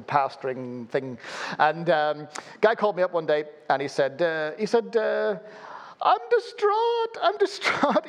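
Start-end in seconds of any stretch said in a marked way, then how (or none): 6.0-8.1 high, slow, put-on wail of a distraught man; distraught caller. Retelling a panicked phone call, high wailing caller. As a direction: ham up the upset caller's plea.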